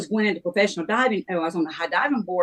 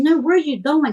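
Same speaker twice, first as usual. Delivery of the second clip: upset question; 9 semitones higher, 5 dB louder than usual. Retold or imitated. Retelling upset plea to roommate.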